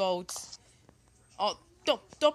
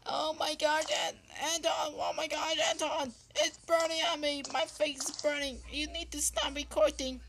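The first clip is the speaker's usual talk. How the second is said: high panicked voice for the phone; 11 semitones higher, 3 dB louder than usual. Acted. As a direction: squeal it out as the burning phone. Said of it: "It said".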